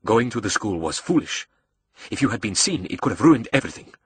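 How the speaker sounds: controlled rebuke, building at the end; a hint of a displeased villain boss; lightly voiced stern mastermind. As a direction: reprimand a reckless young accomplice. Razim rebukes Julius in story.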